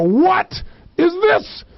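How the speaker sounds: high-pitched, exasperated disbelief; hammed-up indignant question; mock incredulity. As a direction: confront them with the obvious, voice way up. Acted finder confronting the searcher.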